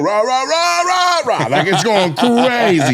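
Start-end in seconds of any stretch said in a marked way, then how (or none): 0.0-1.5 high, climbing rahs; pitched-up rhythmic crowd roar; imitated crowd chant. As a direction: answer like a whole crowd going wild. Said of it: "the crowd is going".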